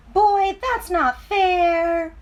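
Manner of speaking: high, slow whine of a pigtail; whining pigtail. Acted. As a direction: drag out the complaint like a sulky pigtail. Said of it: "whined the pigtail".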